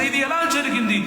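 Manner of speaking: anguished cry. Acted. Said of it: "Gideon అక్కడ ఏడుస్తున్నారు".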